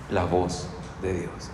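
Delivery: soft and slow, almost reverent; hushed reverent pause on God's voice. Shown as a demonstration. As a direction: let the words sink in quietly. Repeating scripture phrase softly.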